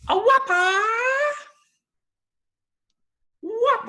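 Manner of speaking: high shouted kung fu cry; Bruce Lee kiai. Retold or imitated. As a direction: yell it like a martial arts kiai.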